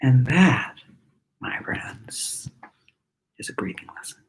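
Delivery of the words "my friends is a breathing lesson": hushed whispered sign-off. Acted. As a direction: whisper the closing words to the class. Intimate close of lesson.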